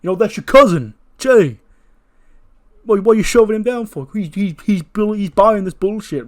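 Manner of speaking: high, loud, stammering outrage; angry yelling. Acted. Jacob's defender confronts Jay over the shove, yelling angrily. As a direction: yell in disbelief, tripping over words. He goes.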